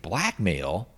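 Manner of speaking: startled questioning echo; mildly incredulous one-word question; taken aback. Retold or imitated. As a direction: imitate someone caught off guard by an accusation. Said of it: "John is genuinely sort of taken aback".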